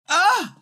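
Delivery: very high acted "Ah!"; peasant's high cry of fright; frightened cry. A narrator's reading. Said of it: "cried the poor peasant man".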